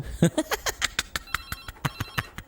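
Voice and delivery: high squealing laugh; very high embarrassed burst of laughter; startled laugh. Acted. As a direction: laugh off the scare. Boss laughs after being startled.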